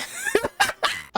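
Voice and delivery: squealing high-pitched giggle; high laugh. Real, not acted. Streamer cracking up at his own flirting bit.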